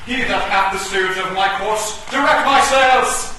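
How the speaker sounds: a knight's stage cry; grand proclamation. Acted. Shakespearean battle proclamation.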